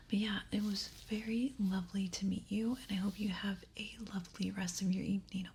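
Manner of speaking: ordinary talk, nothing marked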